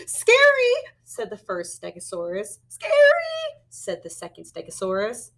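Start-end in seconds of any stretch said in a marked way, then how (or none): 0.1-0.7 very high, loud scared cry; frightened squeal. Acted. Dinosaur sees predator. 2.7-3.6 very high, loud scared squeal; frightened squeal. Acted. Second dinosaur echoes in fear.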